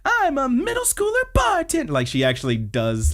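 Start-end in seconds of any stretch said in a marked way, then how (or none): 0.0-1.9 very high, excited idol squeal; idol announcement. Retold or imitated. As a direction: announce it like an overexcited pop idol. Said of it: "like you're an idol announcing a present".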